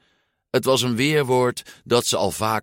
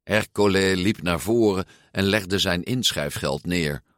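usual speaking voice

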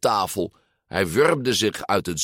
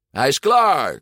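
high, emphatic declaration; loud announcement; 6 semitones higher, 5 dB louder than usual. A narrator's reading. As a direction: announce it loudly for all to hear. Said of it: "kondigde Ercole aan".

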